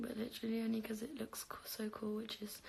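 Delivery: whispered aside. Real, not acted. Confessing to viewers in a guilty whisper. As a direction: drop to a sneaky whisper. Confessing smoking looks cool.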